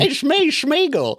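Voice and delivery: high squeezed creature voice, laughing; high Gollum squeak through a laugh; imitating Gollum. Shown as a demonstration. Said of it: "Just go".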